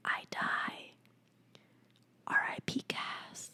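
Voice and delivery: faint unvoiced whisper; whispered mock death. Real, not acted. Overwhelmed by a favorite line, mock-dying whisper. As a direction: breathe it out like you're fading.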